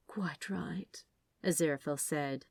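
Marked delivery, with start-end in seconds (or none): 0.1-1.0 soft whisper. Acted. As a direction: breathe it gently. Angel soothes serpent.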